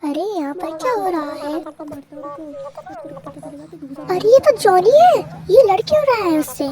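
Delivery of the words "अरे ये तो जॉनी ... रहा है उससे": loud, raised cry of surprise; alarmed surprise. Acted. Sister spots Johnny fighting, startled and loud. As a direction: exclaim higher and louder at the sight.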